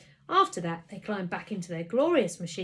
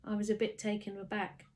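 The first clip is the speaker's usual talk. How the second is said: ordinary delivery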